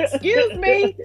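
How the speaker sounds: rising, prim excuse me; mock polite exit. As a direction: edge toward the door politely. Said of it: "let me get my phone".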